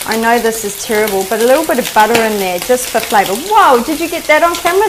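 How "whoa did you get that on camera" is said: excited whoa. Real, not acted. Something cool happens in pan.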